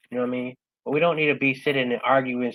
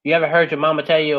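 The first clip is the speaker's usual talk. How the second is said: normal talking voice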